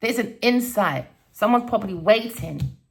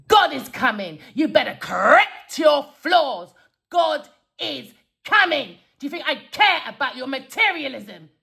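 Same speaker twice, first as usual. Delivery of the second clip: raised voice, punching the key words; tense angry rebuke; 3 semitones higher than usual. Retold or imitated. Telling the card lady off for her materialism, tense and angry. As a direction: lay down a furious, emphatic warning. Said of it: "Sorry, that was tense".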